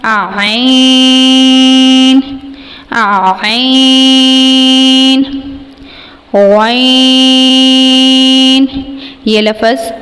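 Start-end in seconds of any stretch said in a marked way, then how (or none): 0.0-5.2 عین (written آئین) held long from mid-throat; drawn-out throat letter. Shown as a demonstration. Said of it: "یہ وسط حلق سے لفظ ادا ہوگا". 6.3-8.7 غین held long and gargled from the upper throat; drawn-out throat letter. Shown as a demonstration. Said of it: "ابتدائی حلق سے ادا ہوگا".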